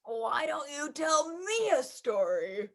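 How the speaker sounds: low, slow, sleepy yawn through the words; a drowsy dad mid-yawn. Acted. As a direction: stretch it out as he yawns.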